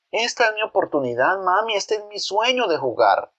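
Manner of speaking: put-on high, pleading boy voice, words tumbling out; desperate boy. Boy pleads with his mother to let him play, desperate. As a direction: beg as if this is the only chance ever.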